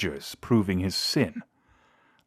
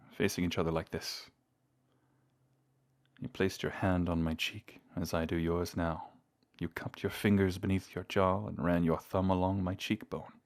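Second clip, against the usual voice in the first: soft, close reminiscing; tender intimacy; 6 dB quieter than usual. Acted. Vampire touching lover's cheek as they remember, soft intimacy. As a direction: recall a first touch gently and quietly.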